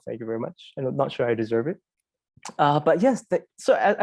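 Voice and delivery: ordinary talk, nothing marked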